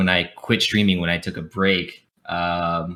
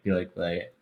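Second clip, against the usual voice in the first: usual speaking voice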